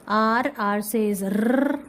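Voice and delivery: r sounded long and slow; slow teacher phonics r. Shown as a demonstration. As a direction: slowly stretch out the r sound. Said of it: "Like this".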